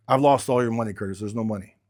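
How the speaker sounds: usual speaking voice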